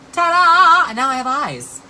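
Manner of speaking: high, loud mock ta-da; hammed-up, high-pitched proud announcement; triumphant reveal. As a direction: show off a silly reveal with fanfare. Acting out performer's makeup reveal.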